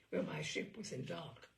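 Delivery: usual speaking voice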